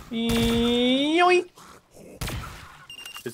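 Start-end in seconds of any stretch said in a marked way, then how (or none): 0.1-1.5 high, cartoonish grab cry; snatch it like a cartoon thief; playful yoink. Grabbing weapon playfully.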